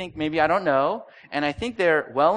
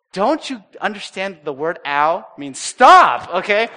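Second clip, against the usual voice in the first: exasperated; 3 semitones higher, 9 dB louder, slower than usual. Retold or imitated. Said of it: "I wanted to say".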